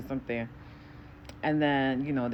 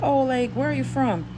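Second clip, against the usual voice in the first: high curious voice; 10 semitones higher, 4 dB louder than usual. Retold or imitated. Lady asks origin.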